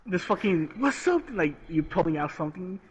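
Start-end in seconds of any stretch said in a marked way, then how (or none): 0.8-1.2 mock tough guy. Acting tough to scare people.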